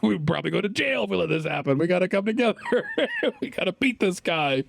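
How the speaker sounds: rally the neighbors, hammed up; patriotic dad. Dads rallying against foreigner.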